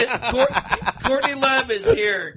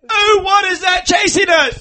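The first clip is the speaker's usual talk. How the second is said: startled shout, higher and louder; panicked royal cry from a car; Princess Diana; 6 semitones higher, 5 dB louder than usual. Acted. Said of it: "here's my Princess Di".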